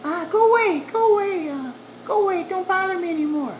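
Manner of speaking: high, loud woman shooing him off; annoyed woman behind the door. Retold or imitated. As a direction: shout go away through the door. Woman repeatedly dismissing him.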